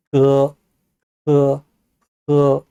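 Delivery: 뜨, 뜨, 뜨 rising from deep inside the throat; deep tongue-root sounds. Shown as a demonstration. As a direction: hold each 뜨 long and level from the tongue root. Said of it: "목구멍 안쪽 깊은 곳에 혀 뿌리 부분에서".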